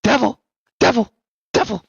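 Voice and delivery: high, frightened shrieks fading down; terrified accusation. Acted. Madwoman shrieking at Oni in fear. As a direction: point and cry out in terror. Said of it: "she's like".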